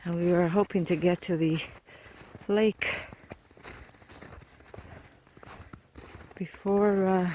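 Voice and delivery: breathless, catching breath; winded from shoveling snow; gasping for air. Real, not acted. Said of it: "I'm sorry I'm out of breath".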